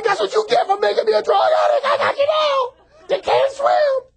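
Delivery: high, loud triumphant taunt; gloating shout. Pretend. Addict taunting the drowning devil, gloating shout. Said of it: "You're like".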